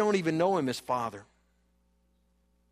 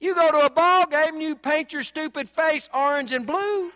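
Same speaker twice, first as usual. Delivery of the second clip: laughing jab; 8 semitones higher, 5 dB louder than usual. Real, not acted. Preacher mocks sports fans, laughing.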